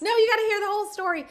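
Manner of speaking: high, loud, rushed and eager; eager storyteller. Retold or imitated. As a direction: blurt it out, bursting to tell all. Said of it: "it's like".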